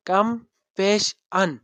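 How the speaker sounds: "compassion" split slowly into com, pash, un; syllable by syllable. Shown as a demonstration. Teacher counting syllables for students, "compassion" said syllable by syllable. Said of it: "number of syllable three".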